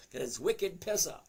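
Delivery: put-on a touch of Boston accent; "wicked pisser" in a playful Boston voice; Boston slang. To chat, Boston joke, playful accent. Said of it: "that's Bostonian for you".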